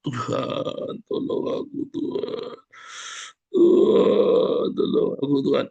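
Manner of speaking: breathy whispered whimper, pitch squeaking high; mock whimpering. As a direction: whine a feeble prayer in a faint whisper. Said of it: "pasrah di dalam rintihanmu". (rintihan berbisik yang dibuat-buat)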